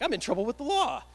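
high, loud comic alarm; mock panic. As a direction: wail it like you're in big trouble. Acts out panic over warrant.

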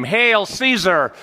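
slow high ringing shout of hail; crowd acclaim. Acted. Crowd hailing the emperor, loud slow proclamation.

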